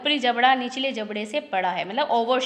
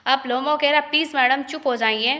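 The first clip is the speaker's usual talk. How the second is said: normal talking voice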